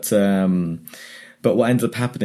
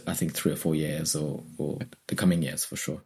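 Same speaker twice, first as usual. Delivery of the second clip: trailing off; 5 semitones lower, 10 dB quieter than usual. Real, not acted. Voice fades away.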